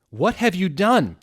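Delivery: high, loud, slow mock wail; crying out. A narrator's reading. Dumbledore confronting the band, a high loud slow cry. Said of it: "he started to cry wisely".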